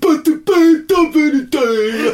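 high, slow put-on voice; mock high voice. Podcast host opening in a silly high voice. Mocking falsetto opener.

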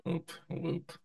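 timid, nearly silent whoop whoop; scared quiet whoop. Real, not acted. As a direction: shrink away, too scared to cheer.

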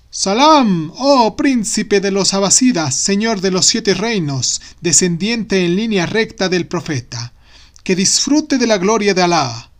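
high, loud fawning vizier; bow and flatter grandly. Acted. Vizier greets the caliph.

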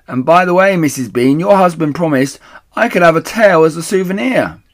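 loud, high eager call. Acted. The woman calls up about the tail, eager and loud. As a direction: shout up for her prize.

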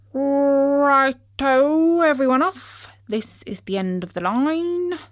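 long drawn-out, loud "Righto"; booming announcement to a busload; bus driver calling out. Acted. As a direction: call the passengers off the bus. Driver calls passengers off the bus.

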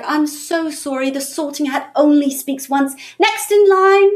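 high, mock-polite, building to a laughing shout; sweetly ruthless prefect turning kids away; fake-sorry official. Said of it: "And I'd be like".

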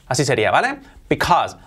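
ordinary talk

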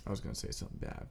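quick, breathy whisper to yourself; hushed self-censoring whisper; whispered aside. Real, not acted. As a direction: drop to a fast whisper as if confessing.

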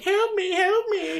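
very high, squeaky cry for help; mock old woman. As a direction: plead in a piercing old-lady falsetto. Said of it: "in an old woman costume".

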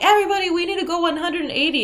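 high, fast, loud captain's call to the crew; shouted order. Retold or imitated.

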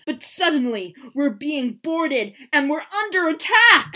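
loud, rising make-believe alarm; dramatic alarm. Pretend. Oliver pretends pirates are boarding, building alarm. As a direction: build to a mock battle cry.

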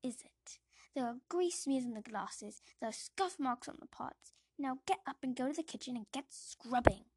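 loud cold rebuke; Aunt Petunia. Acted. Aunt correcting the boy, loud and cold. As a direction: cut him down coldly. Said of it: "says Aunt Petunia".

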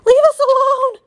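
very high, loud squeak; frightened little animal's squeaky cry; squeaking weasel. A narrator's reading. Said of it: "squeaked Dart".

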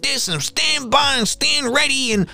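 high caricature voice listing his slogans; mocking a supporter. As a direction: ape a gleeful fan repeating his lines. Imitating racist supporters quoting Trump.

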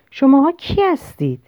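a touch of anger, pitched high; mildly angry. A narrator's reading. Angry narrator challenges boyfriend about who they are, mildly indignant. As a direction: demand it with mild anger, voice lifted. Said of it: "عصبانی بودم".

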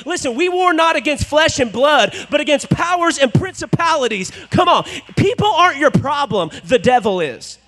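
loud, driving sermon climax; full-force preaching. Real, not acted. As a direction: preach it out to the room.

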